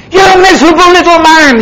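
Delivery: high, fast, scornful rebuke from an angry king. Acted. King scorning the servant he forgave, angry high rebuke. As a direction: spit it at the servant.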